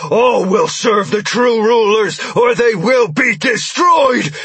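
loud, slow, menacing proclamation; booming villain. Acted. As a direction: bellow it like a tyrant. Villain declares doom to captives.

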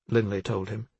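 ordinary delivery, nothing marked

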